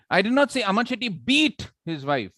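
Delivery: high-pitched run peaking on "beat", "his wife" falling; stress on BEAT. Shown as a demonstration. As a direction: build up to a hard-hit "beat". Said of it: "He might have done something else".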